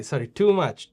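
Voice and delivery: normal talking voice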